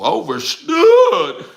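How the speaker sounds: high, loud, stretched-out word; drawn-out exclamation. Real, not acted. Streamer reacts to the figure, high drawn-out cry.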